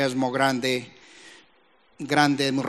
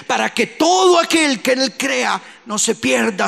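full-force preaching; 8 semitones higher, 5 dB louder than usual. Real, not acted. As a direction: cry the verse out from the pulpit.

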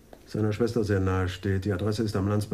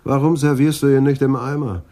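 louder, higher mocking complaint; loud sarcastic jab; 7 semitones higher, 10 dB louder than usual. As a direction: bark it at the boy behind the counter. Sneer at the bad coffee. (lauter, höhnischer Vorwurf)

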